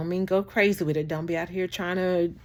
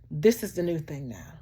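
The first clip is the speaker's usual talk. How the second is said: ordinary delivery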